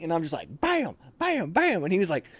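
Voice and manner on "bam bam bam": mock gunshots. Imitating shots.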